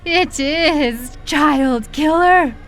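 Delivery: high, gloating and triumphant; prideful. A narrator's reading. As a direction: savor the accusation proudly. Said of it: "I said pridefully".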